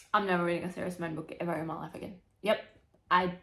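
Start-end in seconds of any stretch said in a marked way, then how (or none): 0.1-2.1 fast, low mock threat; rushed vow. Real, not acted. Reader vowing to quit the author, fast and low. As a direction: rattle off the threat.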